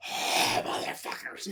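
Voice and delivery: hushed, breath-only curse; mock stunned whisper under the breath; whispered dismay. Voicing Stripe watching everyone get burned, stunned whisper.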